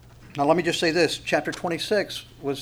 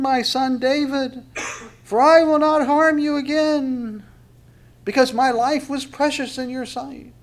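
hammed-up crying out, high and loud, stretched; Saul; 10 semitones higher, 5 dB louder than usual. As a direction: plead at the top of a put-on high voice. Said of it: "Saul ultimately cries out".

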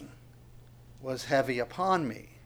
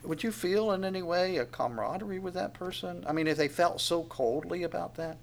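ordinary delivery

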